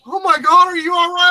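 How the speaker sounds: high, loud mock panic; panicked husband. Woman imitating her husband seeing her cut finger, loud high panic. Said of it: "it's like".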